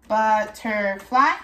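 "butterfly" in three slow beats, rising at the end; split into syllables. Shown as a demonstration. Teacher recaps three-syllable words, "butterfly" split and rising. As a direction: list "butterfly" piece by piece, ending upward. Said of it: "words with three syllables".

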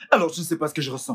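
fast, bitter retort; bitter rapid retort. Acted. Husband snaps back at the doctor, fast. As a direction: rattle it off bitterly.

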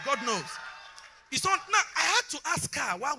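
loud, high preaching build; preaching at full force. Acted. Pastor preaching loudly to the congregation.

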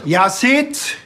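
loud call with a rising end. Acted. Cop calling Yazid over to the counter, loud rising call. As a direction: shout his name across the shop.

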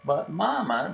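high, whiny little cub protest. Acted. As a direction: plead like a young cub caught out. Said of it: "they protested".

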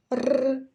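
"р" alone as a held trill; hard consonant sound. Shown as a demonstration. Teacher gives children the first sound, hard trilled "р". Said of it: "твердий".

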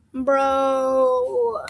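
normal talking voice

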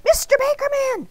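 high, loud, urgent call; frantic squeal, not truly whispered. A narrator's reading. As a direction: get his attention in a panic. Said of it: "Arnie frantically whispered".

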